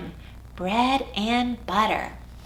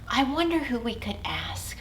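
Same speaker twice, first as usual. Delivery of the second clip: whisper; 7 dB quieter than usual. Acted. Whispering secret to kids.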